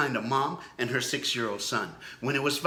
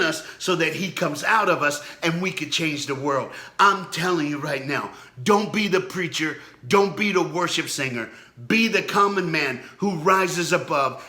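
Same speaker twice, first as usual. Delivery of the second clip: louder, fervent sermon delivery; preaching; 3 semitones higher, 4 dB louder than usual. Acted. Pastor rallying viewers to become the common man, preaching. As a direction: preach it, pushing each phrase. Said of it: "let me preach to you".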